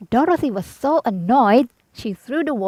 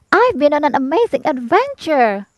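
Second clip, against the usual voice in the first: put-on young Dorothy, excited; 6 semitones higher than usual. Dorothy voiced after her return.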